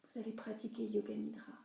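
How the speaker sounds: ordinary talk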